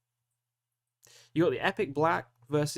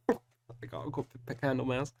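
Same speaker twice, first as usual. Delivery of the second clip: laughing; 5 dB quieter than usual. Real, not acted. Laughs while making his pick.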